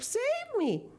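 very high panicked plea; crying out. Acted. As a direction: beg in alarm. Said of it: "she cried".

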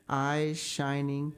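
ordinary delivery

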